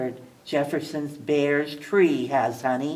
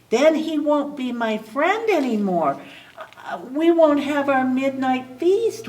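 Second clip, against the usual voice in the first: wailing; 9 semitones higher than usual. A narrator's reading. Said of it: "wailed Figgy".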